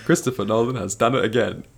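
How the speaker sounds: British celebrity impression with Cockney vowels; Cockney-accented star giving a verdict; Michael Caine. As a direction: do the famous London actor, amused. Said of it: "I just think about it in his voice".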